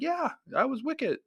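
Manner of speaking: high, light voiced reply; imitating Davis. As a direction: say it like a modest star.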